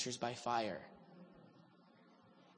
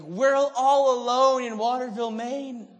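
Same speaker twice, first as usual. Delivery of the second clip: mock whine; 9 semitones higher, 11 dB louder, slower than usual.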